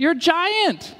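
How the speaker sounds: incredulous, pitch leaping up; exasperated exclamation. Acted. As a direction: cry it out high, in disbelief at the timid giant. Exclaims at the timid giant.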